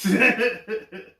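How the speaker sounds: high, loud burst of laughter; sudden high laugh. Real, not acted. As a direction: cackle at the joke. Laugh after spooky line.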